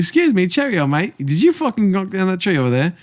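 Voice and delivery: high-pitched mock British queen; British accent. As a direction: put on a hammy British accent. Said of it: "In a British accent".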